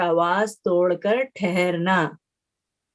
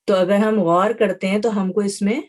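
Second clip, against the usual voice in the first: ordinary talk, nothing marked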